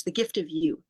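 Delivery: ordinary talk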